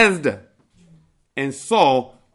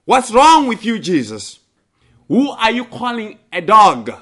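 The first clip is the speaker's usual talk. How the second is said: loud, high, indignant retort; mock indignant outburst; 6 semitones higher, 6 dB louder than usual. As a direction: snap back as if insulted. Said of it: "go crazy and say".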